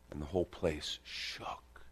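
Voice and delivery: slow hushed whisper; awed whisper. Acted. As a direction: breathe it out in awe. Awed climax of vision.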